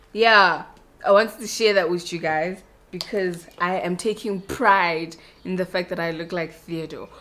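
ordinary talk, nothing marked

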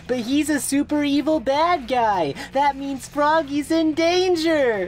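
high-pitched worried outcry; alarmed. Acted. Big learning a villain has Froggy, alarmed. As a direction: panic over a missing pet.

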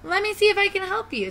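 high, quick mousy offer; little storybook mouse. Acted. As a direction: speak fast and high as a helpful mouse. Mouse character offers help.

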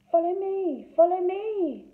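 high, sing-song calling; high bird voice calling them onward. A narrator's reading. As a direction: lure them in from the branches. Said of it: "they heard a voice calling".

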